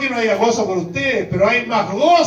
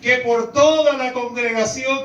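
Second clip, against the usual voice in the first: calling out; 6 semitones higher, slower than usual. Retold or imitated. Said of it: "es para decirle".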